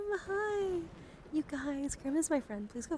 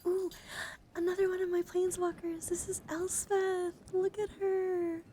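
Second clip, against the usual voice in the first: excited gush; 10 semitones higher, 7 dB louder than usual. Real, not acted. Showing off her Elspeth planeswalker, loud excited gushing. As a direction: break out of the whisper to gush. Thrilled at her favorite card.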